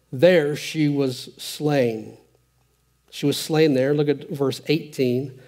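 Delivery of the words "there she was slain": drawn out, each word weighed; slow and grave. Acted. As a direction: let the death sink in slowly. Dramatic reading of her death.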